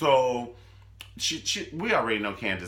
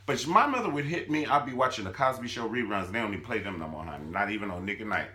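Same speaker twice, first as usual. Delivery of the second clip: usual speaking voice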